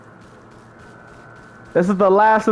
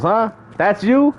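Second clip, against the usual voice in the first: normal talking voice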